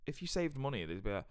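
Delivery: usual speaking voice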